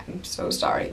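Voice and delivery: unvoiced apologetic whisper; sheepish whisper. To the old ladies who glared at her, a sheepish whisper. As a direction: murmur the apology, embarrassed. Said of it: "I was like".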